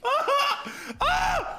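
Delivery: very high squeal of panic; panicked shriek. Retold or imitated. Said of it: "I'm like".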